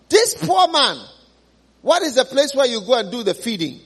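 ordinary talk

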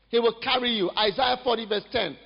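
ordinary delivery

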